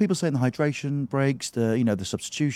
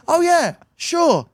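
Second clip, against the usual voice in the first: high, drawn-out agreeable reply; imitated neighbour; 5 dB louder, slower than usual. Neighbour accepting a put-off at the gate, high imitation. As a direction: mimic an oblivious caller, pitched way up.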